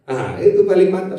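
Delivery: normal talking voice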